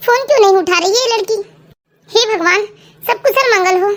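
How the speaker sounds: usual speaking voice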